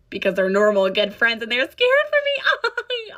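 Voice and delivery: sarcastic mock. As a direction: sneer at his fake concern. Mock quoting Derek, sarcastic.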